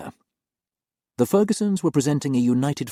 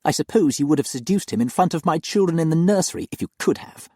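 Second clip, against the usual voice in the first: louder, higher and venomous; spat out; 10 semitones higher, 5 dB louder than usual. Acted. Jealous mistress spits an accusation at the nanny, venomous. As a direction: spit the accusation at her. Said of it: "Eugenia spat at her".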